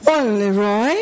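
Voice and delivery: high-pitched, incredulous mock outrage; judgmental disbelief. Speaker judging Roy for the shirt, scandalized. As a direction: swoop up as if appalled by an outfit.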